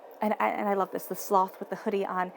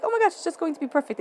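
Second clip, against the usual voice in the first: higher and fast, gushing with excitement; over-excited gush; 8 semitones higher, 4 dB louder, faster than usual. Retold or imitated. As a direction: let the excitement run away with you. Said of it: "I know sometimes I get so excited".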